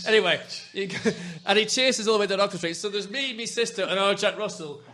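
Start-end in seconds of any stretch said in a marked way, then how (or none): none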